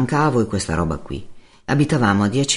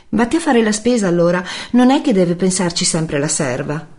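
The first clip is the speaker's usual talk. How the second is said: high-pitched, nagging woman's voice; irritated Giulia; 10 semitones higher, 4 dB louder than usual. Acted. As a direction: scold him in a high female voice. Giulia litiga al telefono. (voce acuta di donna irritata)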